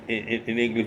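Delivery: ordinary talk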